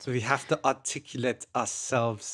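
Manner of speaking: a little bit of Hans Gruber; German accent. Retold or imitated. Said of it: "I'm putting a little bit of Gruber in there".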